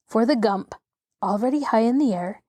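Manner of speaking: ordinary delivery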